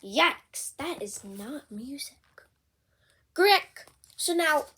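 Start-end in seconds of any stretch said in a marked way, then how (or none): 0.8-2.2 breathy whisper, barely voiced; whispered thought. Gerald thinks to himself about Piggy's noise, whispered. 3.3-3.9 high, loud brassy blurt into the trumpet. Acted. Piggy blows her trumpet for Gerald, a loud honk.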